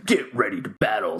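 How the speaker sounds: low announcer call; fighting game announcer. Acted. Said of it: "That's actually from Tekken".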